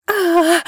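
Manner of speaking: high, loud groan of pain; pained moan. A narrator's reading. Wife in labour hit by pain, pained moan. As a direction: cry out in a sudden contraction. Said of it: "Мой стон напугал даже меня".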